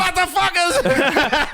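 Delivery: slow, high, loud mock German yell; mock German accent. Tom Cruise imagined swearing in a German accent. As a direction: ham up a bad German accent. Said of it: "Tom Cruise in a German accent".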